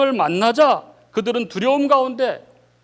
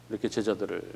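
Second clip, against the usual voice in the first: ordinary delivery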